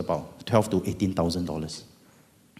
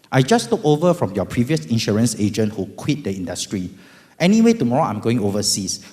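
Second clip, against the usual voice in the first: put-on cheery, loud young agent voice; breezy salesman greeting; insurance agent; 4 semitones higher, 6 dB louder than usual. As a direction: agent leaving a friendly message. Voicing insurance agent's message.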